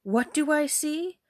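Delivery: high, slow, aghast question; horrified cry. Acted. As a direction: cry out in shocked disbelief. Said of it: "cried her mother".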